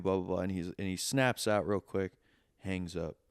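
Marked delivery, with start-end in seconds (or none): none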